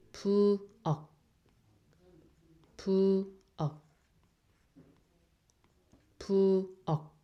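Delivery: "pu o" said slowly, two clear separate syllables; teacher models "pu o" for learners; Korean syllables pu o. Shown as a demonstration. Teacher sounding out "pu o" slowly for students. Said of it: "together you make".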